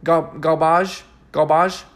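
questioning French-accented garbage, ending in -bahzh; mock French accent. Shown as a demonstration. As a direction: feel out a French pronunciation. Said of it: "how do they say it in Fr-, French".

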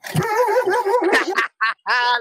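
loud, high burst of real laughter; cracking up at the trick; big real laugh. Real, not acted. Friend tricked him on stream.

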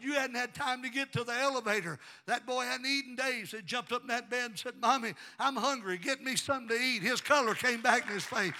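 high, loud, building testimony; full-force preaching. Real, not acted. Preacher telling of the boy's healing, building loud and high. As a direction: drive the story upward toward a shout.

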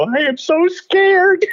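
very high, drawn-out comic whimper of fear; mock terrified plea. Performer on knees to the star, mock terrified. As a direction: exaggerate stage fright, high and slow. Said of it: "look up at him and go".

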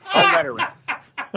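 slow, high, laughing plea; laughing mock warning. Comic dad repeating the store's ban, laughing high and slow. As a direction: stretch it out through a laugh, pitched high.

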